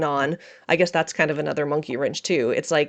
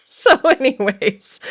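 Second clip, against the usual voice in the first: laughing; 9 semitones higher, 7 dB louder, much slower than usual. Real, not acted. Laughing transition after heavy talk.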